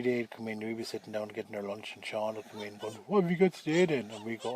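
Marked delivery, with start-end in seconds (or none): 3.1-4.1 a louder, higher, cocky schoolyard bully; bully's demand. Retold or imitated.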